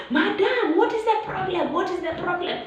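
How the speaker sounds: high, concerned bystander voice; worried passer-by asking; onlookers asking. Retold or imitated. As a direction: call out to a frantic woman. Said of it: "everyone is looking at us and asking".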